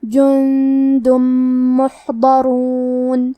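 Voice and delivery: "جندم محضرون" chanted slowly, tanween turned into a long hummed M; slow tajweed "جندم محضرون", nasal merged meem; merging with ghunnah. Shown as a demonstration. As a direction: hold the doubled M between "جندم" and "محضرون" as a hum. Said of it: "غنہ اور تشدید کے ساتھ".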